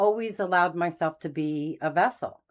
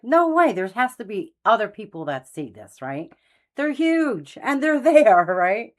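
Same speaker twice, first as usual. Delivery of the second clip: much higher and louder, laughing in amazement; excited disbelief; 5 semitones higher, 7 dB louder than usual. Retold or imitated. Recalling seeing angels at Mass, loud high astonished disbelief. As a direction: burst out in astonished glee. Can't believe nobody sees.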